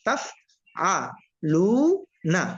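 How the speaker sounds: তাফআলুনা split into taf, a, lu, na; slow model reading, pausing inside তাফআলুনা; one syllable at a time. Shown as a demonstration. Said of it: "একটা একটা করে বলবেন".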